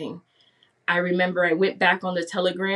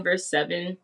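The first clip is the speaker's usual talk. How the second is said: normal talking voice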